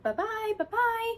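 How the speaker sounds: high lilting bye-bye; singsong farewell to the bird; leaving the parrot's room; sing-song bye-bye. Shown as a demonstration. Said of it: "And I would be like".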